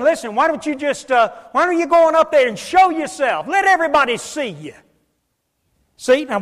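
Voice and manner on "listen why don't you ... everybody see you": raised, goading taunt; mocking. Brothers taunt Jesus to show himself, mocking. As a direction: egg him on mockingly, higher and louder. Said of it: "mocking and making fun of him".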